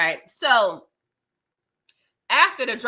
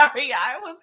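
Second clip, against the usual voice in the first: ordinary talk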